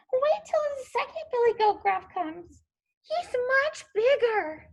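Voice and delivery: high, small pleading goat. A narrator's reading. Smallest goat begs the troll, in a very small voice. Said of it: "in his very small voice".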